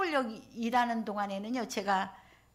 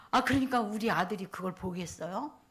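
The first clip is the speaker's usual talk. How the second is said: ordinary delivery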